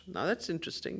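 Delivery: normal talking voice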